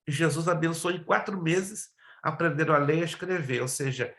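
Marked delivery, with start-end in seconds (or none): none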